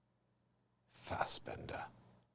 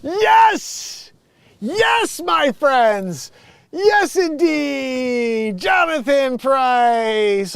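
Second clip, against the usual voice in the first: triumphant cheering; 14 semitones higher, 16 dB louder, much slower than usual. Real, not acted. Streamer celebrates finding the actor to his viewers, triumphant slow shout. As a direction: cheer much higher and louder, drawn out slowly.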